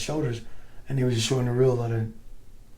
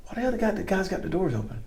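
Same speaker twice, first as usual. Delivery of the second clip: puzzled; 8 semitones higher, 4 dB quieter than usual. Retold or imitated. Retold puzzlement at open doors.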